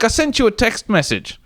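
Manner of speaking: put-on high fussy old-man voice; pointed and prim; [name]. [name] telling James about the text, high and prim. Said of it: "He's like".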